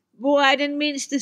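high, loud troll wife's question. A narrator's reading. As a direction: ask in alarm for her youngest. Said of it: "spurgte troldkællingen".

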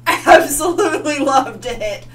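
laughing delight. Real, not acted. Gushing about the headbanging.

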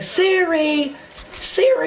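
very high imitation of someone calling Siri; mock screeching. Mocking a friend who calls Siri without a phone, high screech. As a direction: squeal the name high, poking fun. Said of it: "She's like".

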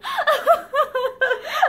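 a high, broken sob; bitter sob. A narrator's reading. Linda collapsing before Barbara, bitter sob. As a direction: break down sobbing. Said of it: "Linda fell to bitter sobbing".

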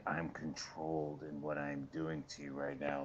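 hushed dad menace, low and soft; quiet menace. Retold or imitated. Imitating dad's quiet menace.

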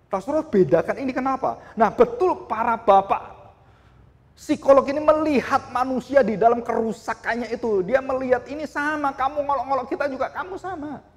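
high, loud pulpit cry building up; preacher thundering at the flock; fiery preaching. Acted. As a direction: rouse the congregation at full voice. Preaching at full force.

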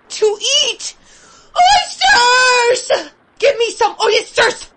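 high, loud begging shout; a starving boy crying out for oysters; desperate cry. Acted. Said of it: "was the cry that broke from me".

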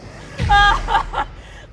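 high, loud labor cry; cry of pain. Acted. Woman in a long contraction, loud cry of pain. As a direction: wail out through the contraction.

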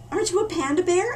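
a bright, curious cub asking; friendly panda voice. Acted. Panda cub greeting the newcomer, eager and high.

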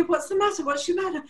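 high, urgent repeated question; anxious concern. Retold or imitated. Stylist to gasping client, high anxious concern. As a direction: fret over someone in mock panic.

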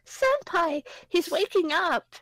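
put-on high, slow anime girl line; a touch of an anime girl; girly anime voice. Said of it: "read the lady in voices".

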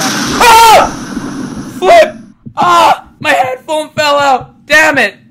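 high, very loud panicked yelling; startled scream. Real, not acted. Player jumping at a scare as headphones fall out, startled screaming. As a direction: shriek in shock and curse. Jump scare while playing.

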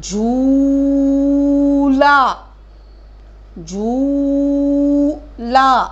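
झूला stretched long, each sound clear; slow drawn-out word. Shown as a demonstration. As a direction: read झूला slowly like a teacher modelling. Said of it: "क्या पढ़ेंगे इसको?".

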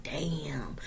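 slow, breathy whisper of dismay; whispered damn. Real, not acted. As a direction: let "damn" fall away to a whisper. Dismayed aside about last days.

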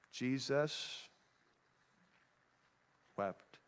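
low, soft, with long pauses; grave whisper of a verse; hushed and solemn. Real, not acted. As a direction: let the two words sink in. Preacher dwells on the verse.

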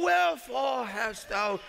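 put-on slow, solemn old-English declaiming; a touch of hammed-up scripture voice; King James reading. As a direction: proclaim it like the old Bible. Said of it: "He said".

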